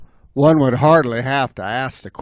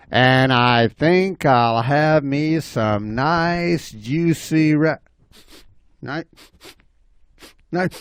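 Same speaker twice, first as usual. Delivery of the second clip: slow, savoring, then breaking off; relishing bobcat; slower than usual. Acted. Bobcat nearing the rabbit, slow relish cut short. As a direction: stretch the words, then stall on syllables.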